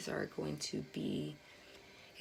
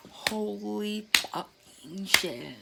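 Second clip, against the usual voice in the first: slow, drawn-out stunned curse; awestruck; 3 semitones higher, 5 dB louder, much slower than usual. Real, not acted. Reader seeing matching cards in both decks, slow and awed. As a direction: let it out slowly in real awe.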